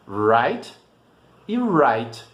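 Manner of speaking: "Right" dito claro, em inglês, com R inglês; pronúncia em inglês. Shown as a demonstration. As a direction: diga "right" duas vezes, igual. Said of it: "possuem exatamente a mesma pronúncia". ("right" em inglês, sem sotaque português)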